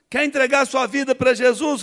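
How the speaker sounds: high, loud pulpit question; preacher asking him to give his life, loud call; preacher's altar call. Retold or imitated. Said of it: "falação dele".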